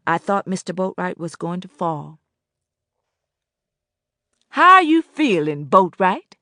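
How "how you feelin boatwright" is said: a booming southern hello across the store; southern drawl. Acted. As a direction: hail him with a hearty southern drawl. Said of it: "drawled in a deep, husky, slightly southern accent".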